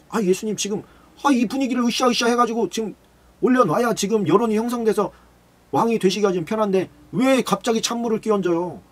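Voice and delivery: high, whiny protesting disciple; complaining disciple. Acted. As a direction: protest like a let-down follower, pitched high. (들뜬 분위기를 깨는 예수님께 높은 목소리로 투덜대는 제자)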